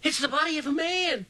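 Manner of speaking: high startled cry of fright; frightened cry. A narrator's reading. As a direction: jump back and cry out in shock. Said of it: "Wally cried".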